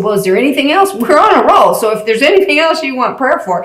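lightly acted upbeat offer; eager offer. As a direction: lift the pitch with eager momentum. Retelling Jay's eager offer to pray.

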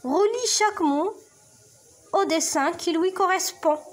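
slow, clear reading of the question; slow teacher read; slow instruction read. Shown as a demonstration. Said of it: "قراو السؤال".

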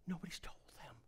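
soft whispered aside; quiet whispered lament. Acted. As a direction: whisper it as a sorrowful secret. Hushed aside before building up.

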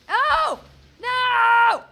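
very loud, high cry; high, drawn-out shout of protest; dismayed yell. Real, not acted. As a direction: yell out as disaster strikes. Disaster during play.

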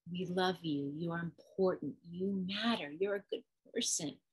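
tender, quiet affirmation; loving reassurance. Acted. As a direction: reassure a child with love. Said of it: "We're gonna put all the love on the person".

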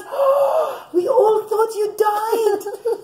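high, slow cry of amazement; surprised. Acted. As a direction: squeal it in disbelief. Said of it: "Osnat's turn to be surprised".

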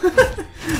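short high laugh; laugh after losing. Real, not acted. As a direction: laugh off your own defeat. Laughs after dying in game. (petit rire aigu)

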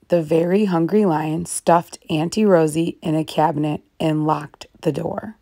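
plain reading voice

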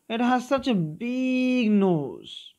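put-on a child marveling at a big cloud nose. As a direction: read it as the little boy, wide-eyed. Teacher voicing child reading story.

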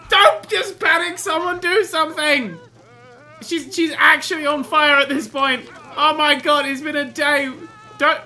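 high, very loud alarm; panicked shouting. Acted. Player urging Sims to save her, frantic shouting. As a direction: shout frantic orders over a fire.

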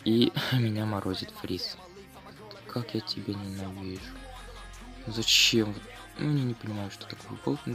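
ordinary talk

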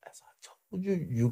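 hushed warning; warning a teammate out of the coach's earshot, whispered; whispered aside. Retold or imitated. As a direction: whisper it to him.

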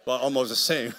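laughing through the words; loud, laughing quip. Real, not acted. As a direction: chuckle the aside out. Laughing at own joke.

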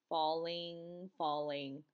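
low, soft, descending words; sinking like a leaf. A narrator's reading. Froggy felt like a falling leaf, sinking softly. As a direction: let each word drift down softly.

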